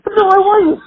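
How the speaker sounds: squeaky fake denial. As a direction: squeak out the lie with wide-eyed innocence. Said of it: "Absolutely I was".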